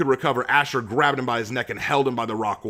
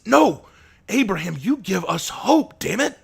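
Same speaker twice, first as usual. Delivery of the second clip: much higher, louder outcry; crying out; 10 semitones higher, 4 dB louder, slower than usual. A narrator's reading. Asher crying out against Abraham's self-blame. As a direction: cry out to stop him. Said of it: "Asher cried".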